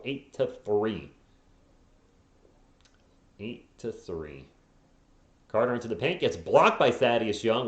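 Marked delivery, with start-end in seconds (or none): 3.4-4.5 softer, deflated repeat; quiet. Real, not acted. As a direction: mutter the score to yourself.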